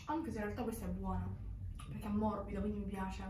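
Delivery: chewing food while talking; speaking between bites; mouth full. Real, not acted. Seen 2: Eats pizza crust before and after; jaw seems to chew while speaking.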